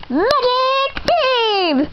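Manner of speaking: slow high spell call; spell shout. Acted. Casting a spell.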